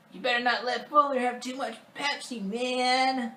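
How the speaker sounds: high-pitched, exaggerated whining; annoying kid voice. Kevin refusing to share a bed with Fuller, annoying whiny voice. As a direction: whine on and on like a pestering child. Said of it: "I had an annoying voice".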